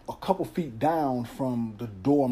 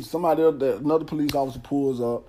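ordinary delivery

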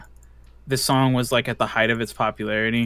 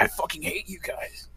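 much softer, near-whispered imitation of a fed-up friend; hushed retold resentment; 3 semitones higher, 11 dB quieter than usual. Danny jumping into the van to his friends, hushed resentment. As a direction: act out his grievance under the breath.

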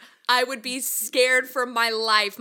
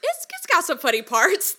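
mock innocent; 5 semitones higher, 4 dB louder than usual. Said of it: "And she goes".

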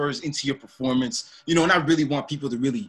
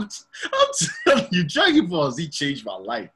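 normal talking voice

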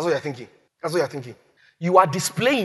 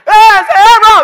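high, very loud frantic call; urgent shout; 13 semitones higher, 18 dB louder than usual. Acted. God shouting to stop Abraham's knife. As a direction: yell his name to stop him. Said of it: "angel of the Lord called".

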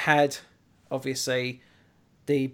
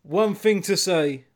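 movie quote as comic book guy; 5 dB louder than usual. Acted. Host teasing his co-host with a comic book guy quote, grand finish. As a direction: declaim it like a movie hero's last words. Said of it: "I will leave you with a quote".

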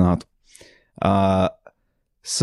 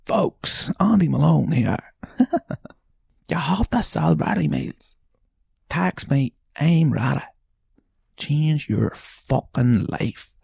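higher-pitched put-on Irish guy voice, drawn-out hype; mock the ready-meals hustler's pitch, pitched up; imitating Andy Malone; 8 semitones higher, slower than usual. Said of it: "for me to imitate you".

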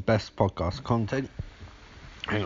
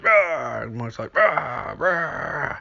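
mock roar; 6 semitones higher, much slower than usual. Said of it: "Michael's like".

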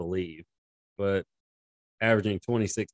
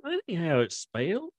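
mocking yokel; 9 semitones higher than usual. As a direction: sass him like a know-it-all local. Mock yokel voices continue.

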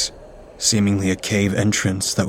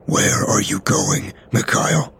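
sharp hissed whisper; hissing whisper; 4 semitones higher, slower than usual. Acted. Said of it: "he hissed in a sharp whisper".

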